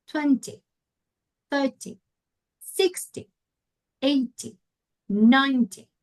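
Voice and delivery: "twenty", "thirty", "sixty", "eighty", "ninety", each hit at the start and falling off; isolated "twenty", "thirty", "sixty", "eighty", "ninety" with weak -ty; first-syllable stress. Shown as a demonstration. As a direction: punch the first syllable of "twenty" through "ninety", one word at a time. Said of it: "focus on the first syllable".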